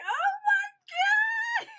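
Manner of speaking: high, thrilled shriek of joy; ecstatic squeal. Retold or imitated. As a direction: shriek it like you just got in. Overjoyed acceptance email reaction.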